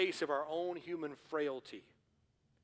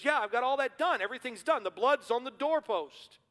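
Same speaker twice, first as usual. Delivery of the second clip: fretful Jones; 8 semitones higher, 5 dB louder than usual. Retold or imitated. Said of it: "He goes".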